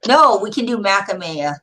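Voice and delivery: ordinary delivery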